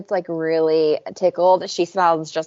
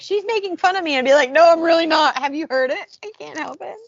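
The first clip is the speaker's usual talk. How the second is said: high-pitched mock protest; pleading in a raised voice, fading off; acted-out squabble; 9 semitones higher, 3 dB louder than usual. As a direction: play up the helpless denial. Acts out complaint and helpless denial.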